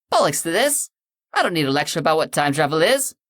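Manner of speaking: cockney bloke fed up, blurting; cockney outburst. Acted. Mister Blue pauses the lecture and protests in cockney, loud. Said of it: "some cockney jackass".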